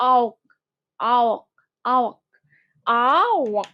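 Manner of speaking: আওক with an open "aa" gliding into a rounded "ok"; rounded-lip আওক. Shown as a demonstration. Teacher drilling the sound for students, আওক with a rounded glide. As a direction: say আওক slowly as two joined sounds, aa-ok, lips rounding. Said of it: "উচ্চারণটা এভাবে করবা".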